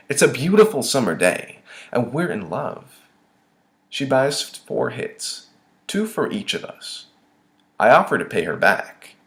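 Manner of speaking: plain reading voice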